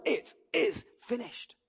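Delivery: slow, high, drawn-out cry of anguish; a soft, slow anguished cry; wailing. A narrator's reading. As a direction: let it out as a mournful wail. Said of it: "the author wails".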